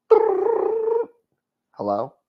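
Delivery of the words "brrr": very high, buzzing brrr; phone ring noise. Pretend.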